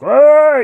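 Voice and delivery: high, loud cartoon growl; mock Homer growl. Imitating Homer's voice at the reading, as a high, loud growl. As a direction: bark it out like a cartoon dad. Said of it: "so good and so dialed in".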